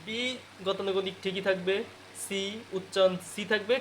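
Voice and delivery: ordinary talk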